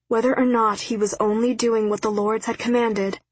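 plain reading voice

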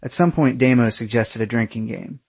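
ordinary delivery, nothing marked

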